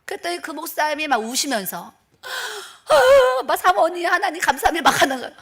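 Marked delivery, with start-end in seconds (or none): 2.9-4.9 sobbing thanks. Retold or imitated. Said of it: "엉엉 우는 거예요".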